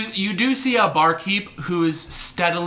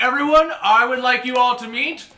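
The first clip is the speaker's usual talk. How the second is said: raised, higher presenting voice; grand announcement; 4 semitones higher than usual. Acted. Bartender introducing the guild to everyone, raised announcing voice. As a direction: present them to the whole crowd.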